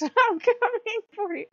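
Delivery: high, laughing threat; playful mock threat. Mock threat amid a playful rant.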